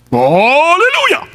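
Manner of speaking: slow, high, loud drawn-out "Hallelujah". Retold or imitated. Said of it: "Kulakasan mo pa".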